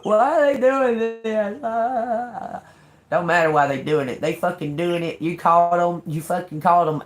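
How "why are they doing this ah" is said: higher, louder mock whine; lightly mocked old whining self; whiny complaint. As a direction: mimic a self-pitying victim. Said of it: "when you was like".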